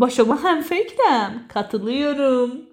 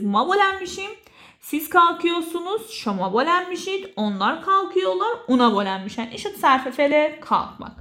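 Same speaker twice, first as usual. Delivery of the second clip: usual speaking voice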